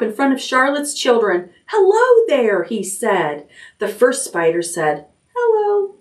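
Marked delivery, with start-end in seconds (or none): 1.7-2.6 bright high pig voice; squeaky excited hello; 1 Wilbur greets spiders high. A narrator's reading. 5.4-5.9 very high tiny squeak; a small, barely heard hello; tiny spider voice. A narrator's reading. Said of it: "its voice was so small".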